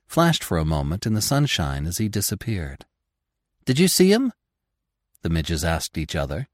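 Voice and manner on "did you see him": surprised. Acted. Midges asking excitedly.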